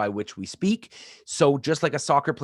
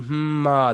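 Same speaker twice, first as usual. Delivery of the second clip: hum then ah; 4 semitones higher, 6 dB louder than usual. Shown as a demonstration. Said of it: "breathe in".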